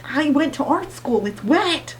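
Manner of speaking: high, indignant pitch; rising outrage at the writing; exasperated. Real, not acted. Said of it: "ranting at the book".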